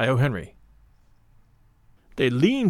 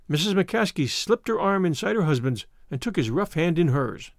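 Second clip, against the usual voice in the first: plain reading voice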